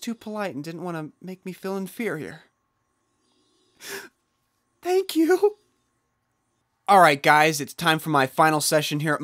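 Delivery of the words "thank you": mock gasp. Sarcastic gasp.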